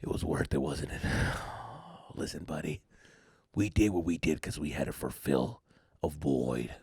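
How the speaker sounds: very low, hushed villain voice; low mirror-self voice; sinister alter ego. Retold or imitated. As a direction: drop deep and quiet, coaxing like a dark side. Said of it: "a different personality".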